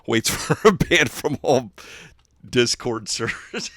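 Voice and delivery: talking through laughter; slow, broken up by giggles; laughing. Real, not acted. Streamer joking about getting banned, laughing through the words. Cracking up at own joke.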